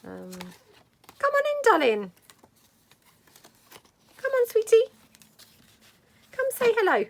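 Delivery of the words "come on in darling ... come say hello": high, loud sing-song coo to the dog; doggy talk. Real, not acted. Coaxing the dog in.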